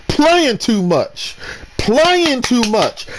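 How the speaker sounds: high, slow chiding; mild mock reprimand; slow scolding. Real, not acted. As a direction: drag it out disapprovingly. Scolding repetition.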